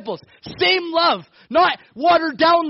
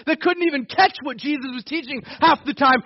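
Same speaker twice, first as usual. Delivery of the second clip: ordinary talk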